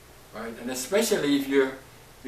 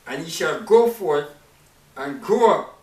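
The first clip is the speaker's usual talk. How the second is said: scripture reading; 3 semitones higher, 7 dB louder than usual. Acted. Preacher reading Malachi to the congregation, declaiming. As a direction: declaim the verse like a prophet.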